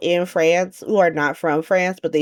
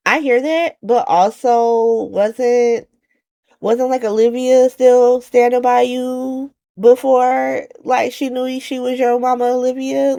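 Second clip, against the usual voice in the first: pointed retort; 8 semitones higher, 5 dB louder than usual. Retold or imitated. Recapper challenging Gio about Olivia standing by him, pointed retort. Acting out her challenge to Gio's speech.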